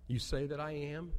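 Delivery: ordinary talk, nothing marked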